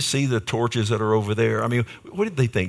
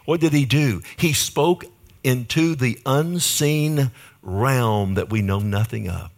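usual speaking voice

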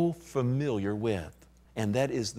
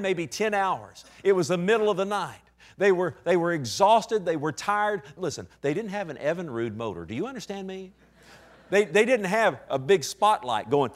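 full-force preaching; 7 semitones higher than usual. Acted. Preacher firing up the congregation about the storm. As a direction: preach it hard to the back pew.

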